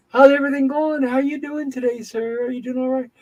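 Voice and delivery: high-pitched, chirpy run of questions; cheery, bubbly. Retold or imitated. X-ray doctor checking on a patient in pain, mock bubbly. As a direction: mimic a relentlessly upbeat doctor. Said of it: "All cheering, bubbly".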